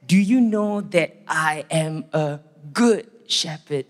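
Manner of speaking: slow, deliberate quoted voice of the Lord; slow divine reminder. Retold or imitated. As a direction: spell it out unhurriedly, word by word. Voicing the Lord's reminder to him.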